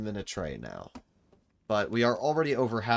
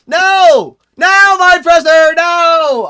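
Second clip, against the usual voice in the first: high, loud cry of dismay; anguished shout; 23 dB louder, much slower than usual. Real, not acted. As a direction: yell no in real despair. His run fails while distracted.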